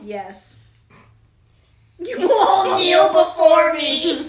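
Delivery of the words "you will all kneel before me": slow, loud villain decree; dark lord. Acted. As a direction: loom over them menacingly.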